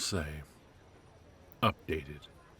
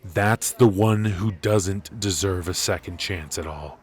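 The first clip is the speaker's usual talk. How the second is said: angry; 3 semitones higher, 9 dB louder than usual. Acted. Angry rant about the family.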